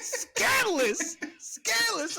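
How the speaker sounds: drawn-out, very high scandalized; hammed-up slow falsetto outrage; mock scandalized. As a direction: stretch it out, shocked and delighted.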